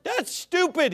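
high mock-outraged imitation; mocking indignation. Preacher quoting the guy on dictionaries, mock indignant. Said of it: "And this is what the guy said".

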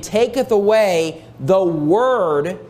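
slow down and land hard on "word"; "the word" set apart and drawn out for emphasis; stress on "word". Shown as a demonstration. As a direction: stretch "word" out so it stands alone. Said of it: "notice how the emphasis is on the word".

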